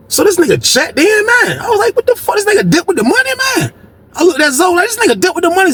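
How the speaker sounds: can't believe he got played; rising disbelief. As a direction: voice climbing in shocked outrage. Disbelief that friend took money.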